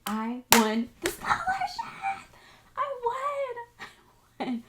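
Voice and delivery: high, laughing shout of joy; ecstatic laughing cry. Retold or imitated. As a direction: burst out with delight as if it just happened. Retelling the moment she won.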